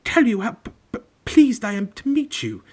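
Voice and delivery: high, nervous stutter on first sounds; Professor Quirrell stammering. A narrator's reading. Pale young teacher meets Harry, stammering nervously. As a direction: trip over the consonants in a high jittery voice. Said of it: "stammered Professor Quirrell".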